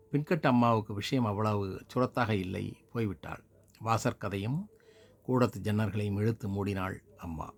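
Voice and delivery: ordinary delivery, nothing marked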